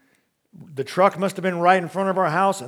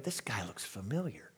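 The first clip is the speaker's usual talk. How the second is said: inner thought; 7 semitones lower, 15 dB quieter than usual. Retold or imitated. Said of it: "I'm thinking".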